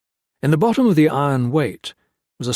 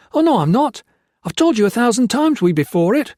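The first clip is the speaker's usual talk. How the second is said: much higher and louder, frantic; frantic retort; 12 semitones higher, 6 dB louder than usual. Acted. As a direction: fire back in panic. Blakely retorts shrilly.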